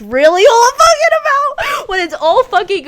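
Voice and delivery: sudden high, loud burst of passion; excited outburst. Real, not acted. Podcaster getting carried away about her favourite movie, excited high shout. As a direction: shout it out as the point hits you.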